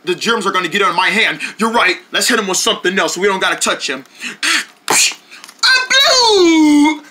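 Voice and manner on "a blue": squeaky figure voice. Acted. Kid playing with toy figures.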